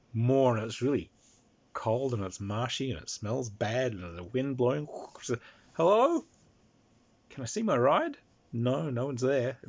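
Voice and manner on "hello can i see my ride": calling out. Acted. Lost character calling out.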